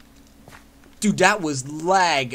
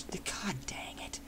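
breathy whisper, much softer and slow; whispered curse; 3 semitones higher, 15 dB quieter, much slower than usual. Real, not acted. Gamer finds chest already looted, whispered curse. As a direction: hush the curse to yourself.

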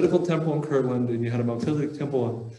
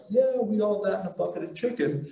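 mock jumping for joy; 7 semitones higher than usual. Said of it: "jumping up and down like".

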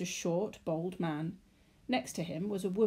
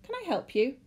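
polite clerk; 7 semitones higher, 6 dB louder than usual. A narrator's reading. Clerk asks.

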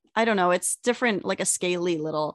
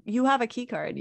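ordinary delivery

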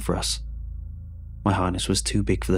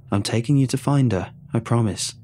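usual speaking voice

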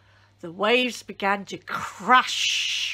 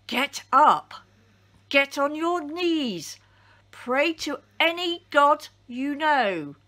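shout, higher and louder, rising toward the end; captain shouting; 6 semitones higher, 5 dB louder than usual. A narrator's reading. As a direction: yell over the roar of a storm. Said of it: "he shouted above the noise of the storm".

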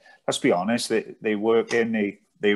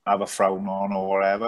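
ordinary talk, nothing marked

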